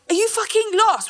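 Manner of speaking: high-pitched indignant challenge; mock outrage. Imagining her ex emerging from the ocean, mock outrage.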